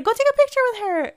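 loud, high, fast urging; imitating urging friend. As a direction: push a friend to hurry, squealing. Acted quote of friend urging her.

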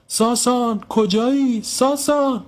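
very high mother's calling; high, projected cries of a searching mother; shouting for someone. A narrator's reading. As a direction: call out for a missing child, voice lifted. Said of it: "مهری خانم بلند داد زد".

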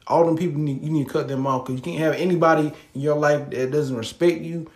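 usual speaking voice

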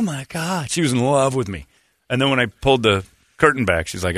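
ordinary talk

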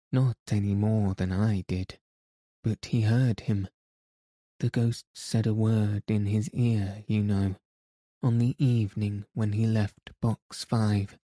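ordinary delivery, nothing marked

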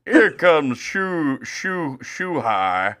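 high, slow, stretched-out announcer voice; old announcer impression. Imitating Mike Shannon announcing Ohtani, slow mock impression. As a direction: drag each syllable like an old broadcaster. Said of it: "Mike Shannon trying to do a Japanese impression".

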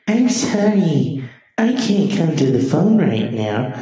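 lightly voiced voicemail greeting; mock voicemail. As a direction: play a recorded message.

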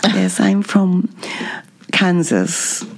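slow, half-whispered self-parody; her own put-on voice. Shown as a demonstration. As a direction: show off a slow, airy affected voice. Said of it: "you have your voice, and I have mine".

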